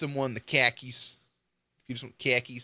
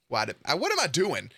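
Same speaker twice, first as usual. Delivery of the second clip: ordinary delivery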